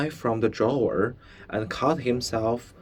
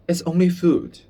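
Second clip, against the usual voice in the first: put-on reassuring boy; 7 semitones higher, 5 dB louder than usual. Bruno reassuring.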